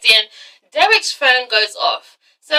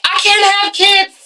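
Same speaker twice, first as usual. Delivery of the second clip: high, drawn-out hammed-up blurt; mock outburst; 8 semitones higher, 3 dB louder, slower than usual. Woman confessing to her boyfriend, mock outburst. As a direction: blurt it high and slow, overacting.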